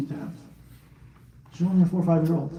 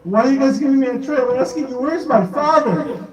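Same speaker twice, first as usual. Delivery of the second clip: put-on pleading little girl; 10 semitones higher, 6 dB louder than usual. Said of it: "she's like".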